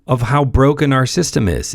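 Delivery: usual speaking voice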